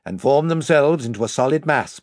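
ordinary delivery

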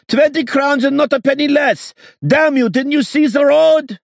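shrill falsetto yelling a price; screeching falsetto. A narrator's reading. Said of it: "screeched a falsetto voice".

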